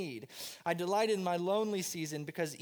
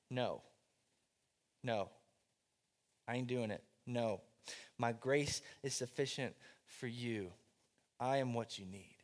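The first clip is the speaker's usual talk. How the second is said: much lower and softer, firm calm refusal; God refusing; 7 semitones lower, 6 dB quieter than usual. Retold or imitated. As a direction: answer as God, low and settled. God answers Paul's plea.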